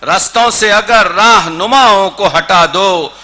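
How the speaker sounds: projected, measured mushaira recital; Urdu poetry recitation. Acted. Poet presents an old couplet to a mushaira audience, declaimed. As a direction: declaim the couplet with ringing emphasis.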